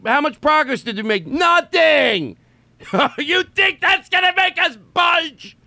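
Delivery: high sneering voice, slow and scornful; mocking taunt. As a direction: jeer like a cruel oppressor. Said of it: "like they're taunting the people they're torturing".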